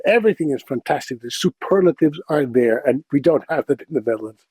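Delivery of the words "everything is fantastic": ordinary delivery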